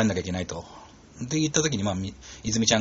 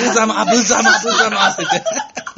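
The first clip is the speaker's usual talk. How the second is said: laughing; 10 semitones higher, 6 dB louder, slower than usual. Real, not acted. Laughing at awkward scene.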